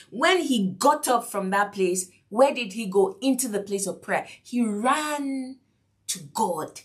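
ordinary delivery

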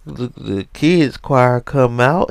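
normal talking voice

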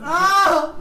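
high loud laughing shriek; shriek of laughter. Real, not acted. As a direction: scream with laughter. Laughing scream.

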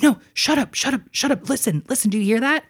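mild mock urgency to make sisters listen; urgent hush. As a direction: hush everyone and strain to hear. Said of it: "I tell my sisters to shut the fuck up".

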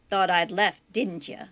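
loud opening jab, then easing; menacing taunt. Acted. Captor taunting Buck as he beats him, menacing. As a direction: taunt a helpless captive.

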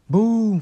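loud high mock explosion "boom"; bomb sound. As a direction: blast it out like a cartoon bomb. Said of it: "fez um som de uma bomba".

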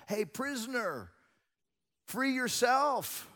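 high taunt, drawn out on the last words; mocking. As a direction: call out to jeer at the prisoner. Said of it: "Soldiers mocked him".

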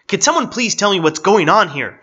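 loud, high, exasperated yell; bellowed. A narrator's reading. Baffled police chief demanding an explanation, bellowed. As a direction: demand answers at the top of your voice. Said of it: "bellowed the chief".

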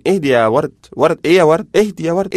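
acted agitated appeal; urgent calming. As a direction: call over the outburst to settle them. He tries calming the raging girl.

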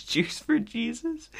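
high, slow put-on pitch of a street solicitor; slow, high mock solicitor asking for donations. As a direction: sing it out like a pushy collector. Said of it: "The guy goes".

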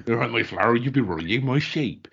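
drawn-out West Country farmer's Bristol talk; Bristol accent. Shown as a demonstration. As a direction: play up a slow Bristol accent. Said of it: "Well, you could do Bristol".

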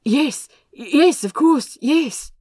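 hurried, anxious to please; flustered agreement. A narrator's reading. Frightened brother agrees with kidnapper, flustered.

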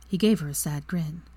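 usual speaking voice

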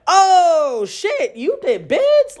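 high, drawn-out shocked voice. Retold or imitated. Hearing her date did time, a slow shocked gasp. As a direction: stretch it out in disbelief. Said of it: "I'm like".

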